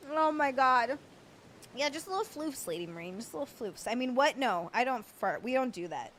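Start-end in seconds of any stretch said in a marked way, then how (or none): none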